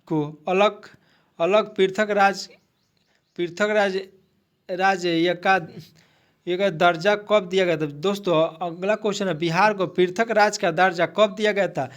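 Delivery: ordinary talk, nothing marked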